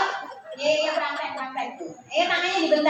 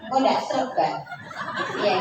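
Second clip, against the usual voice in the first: ordinary talk, nothing marked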